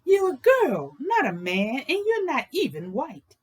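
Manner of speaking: a hint of mocking the dreamer; mild mock scorn from the crowd; scoffing naysayers. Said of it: "People said she was crazy".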